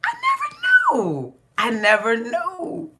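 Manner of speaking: high wailing cry, slow and stretched; Tony Baker Comedy impression. Quoting a comedian about her own moodiness, high slow wail. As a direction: wail it like a comic's catchphrase, slowly. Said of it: "As Tony Baker Comedy says".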